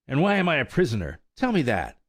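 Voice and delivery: high, fast, indignant colt; defiant demand. A narrator's reading. Colt defying old mare, fast and indignant. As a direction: fire questions back, rebellious. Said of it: "cried he".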